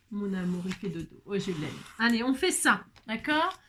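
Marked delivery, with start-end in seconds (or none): 0.1-1.7 tender hush. Real, not acted. Cooing over a sleeping child.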